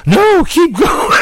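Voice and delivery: squealed very high and slow, mock ecstatic; hammed-up high-pitched moan of pleasure. Partner voiced in a retold bedroom story, high squealing plea. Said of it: "I'm like".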